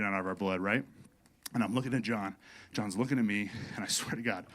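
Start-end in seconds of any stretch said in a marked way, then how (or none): 1.6-4.4 much softer, lower confiding tone; hushed suspense before the punchline. Real, not acted. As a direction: lean in and drop your voice. Building suspense underwater.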